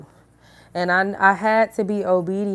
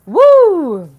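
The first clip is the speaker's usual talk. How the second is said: loud high whoop; woo; 9 semitones higher, 11 dB louder than usual. Real, not acted. To her viewers, a loud high whoop. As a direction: let out a whoop. Exclaiming after laughing.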